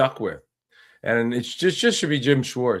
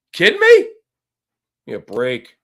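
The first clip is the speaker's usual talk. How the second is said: exasperated disbelief; 5 dB louder than usual. Real, not acted. Ranting about the Browns firing.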